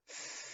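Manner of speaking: a quiet unvoiced th, tongue between the teeth; soft th with the tongue out; th sound. Shown as a demonstration. Teacher demonstrating the th sound. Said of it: "and go".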